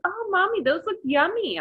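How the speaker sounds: put-on high, sweet little boy voice; little son praising burnt macarons in a high voice; young son. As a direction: chirp it like a small child. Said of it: "he said".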